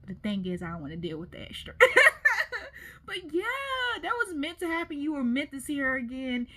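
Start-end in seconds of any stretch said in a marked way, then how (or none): 1.8-3.0 laugh. Real, not acted. Burst of laughter at the story.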